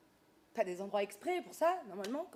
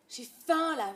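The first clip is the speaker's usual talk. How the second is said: higher, louder hungry protest; loud complaint; 5 semitones higher, 6 dB louder than usual. Acted. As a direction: complain loudly to the room. Hunger complaint gets loud.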